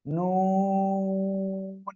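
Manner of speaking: নুন held out long and steady on one tone; long drawn-out nun. Shown as a demonstration. Teacher drawing out নুন for the class. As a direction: stretch the নুন as long as the breath lasts. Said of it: "দন্ত ন।".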